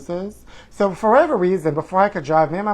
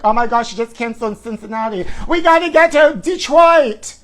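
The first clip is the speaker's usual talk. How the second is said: panicky queen; 6 semitones higher, 5 dB louder than usual. Retold or imitated. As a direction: play a frantic fan who just lost her show. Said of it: "all the queens this year are gonna be like".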